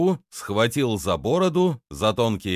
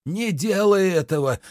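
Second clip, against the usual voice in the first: high-pitched plea to stop; alarmed cry; 10 semitones higher than usual. A narrator's reading. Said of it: "вскрикнул он". (встревоженный вскрик)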